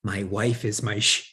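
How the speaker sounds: ordinary talk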